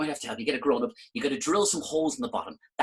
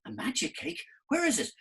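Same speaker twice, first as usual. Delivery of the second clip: put-on girl, surprised; 11 semitones higher, 3 dB quieter than usual.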